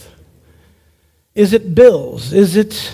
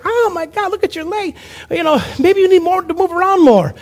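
much higher, alarmed sister voice; freaked-out sister; 7 semitones higher than usual. Retold or imitated. Sister panicking over his swollen leg, high alarmed voice. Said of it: "My sister's freaking out. She's like".

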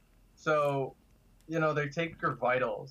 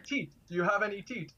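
put-on higher voice in a Jamaican accent, "teeth" said like "tit"; 7 semitones higher, faster than usual. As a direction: play the Jamaican nurse, light accent. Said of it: "thick Jamaican accent".

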